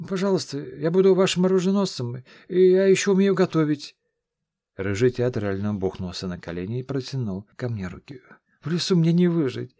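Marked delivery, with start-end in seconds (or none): 0.1-4.0 much higher, louder youthful voice, pleading; a nervous lad begging to tag along; eager young squire. Acted. Gert theatrically begging. 8.6-9.7 much higher, louder youthful voice, pleading; a nervous lad begging to tag along; eager young squire. Acted. Gert pleading.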